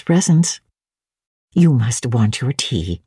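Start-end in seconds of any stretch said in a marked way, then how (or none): 1.5-3.0 lower, slower housekeeper voice; lower, slow voice for the good lady of the house; motherly housekeeper. A narrator's reading. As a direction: speak as the fussing elderly housekeeper. Fairfax voiced in audiobook.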